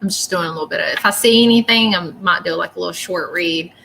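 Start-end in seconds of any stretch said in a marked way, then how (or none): none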